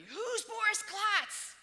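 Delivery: high, loud, slow shout; imitated guards screaming. Guards screaming at her in the airport, loud slow shout. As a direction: bellow it like an angry interrogator. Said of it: "they start screaming at me".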